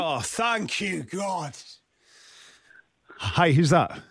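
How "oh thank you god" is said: sag with relief as the call connects; relieved thanks. Real, not acted. Host relieved that a winner picked up, slow and fading.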